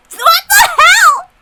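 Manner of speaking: high, stretched-out yell of disbelief; a real what-the-hell scream; shocked shout. Real, not acted. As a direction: blurt it out, stunned and loud. Streamer shocked by misread line.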